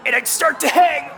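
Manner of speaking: high, strained exclamation; choked cry. Acted. Puppet yanked up by the neck rope, high cry. Acts out being hanged.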